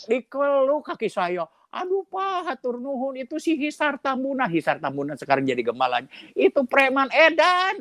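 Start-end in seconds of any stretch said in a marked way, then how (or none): 1.7-4.5 high vendor voice. Retold or imitated. Street vendor voiced in story. 6.3-7.8 high vendor voice. Retold or imitated.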